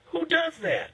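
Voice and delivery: sudden high-pitched disbelief; incredulous outburst. Real, not acted. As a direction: squeal it out in shock. Host's outraged rhetorical question.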